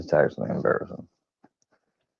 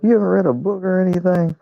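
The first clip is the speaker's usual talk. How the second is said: incredulous friend; 11 semitones higher, faster than usual. Retold or imitated. Imitating his friend's questions.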